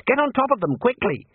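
high-pitched urgent call; urgent command. A narrator's reading. Wizard urges others onto floating seeds, urgent high call.

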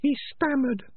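very high, thin rat voice; tiny high-pitched rat, pointed; prim female rat. Acted. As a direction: squeak it out as a small rat. Peaches the rat's put-on voice.

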